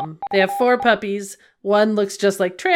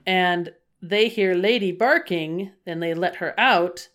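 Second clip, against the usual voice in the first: ordinary delivery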